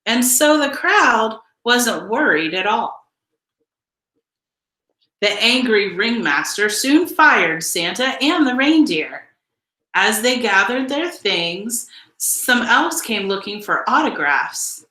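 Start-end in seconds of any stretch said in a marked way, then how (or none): none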